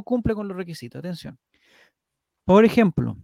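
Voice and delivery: measured article read; reading aloud. Acted. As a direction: read it like an informative text.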